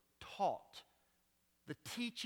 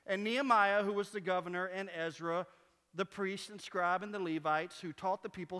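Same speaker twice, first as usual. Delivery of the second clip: ordinary talk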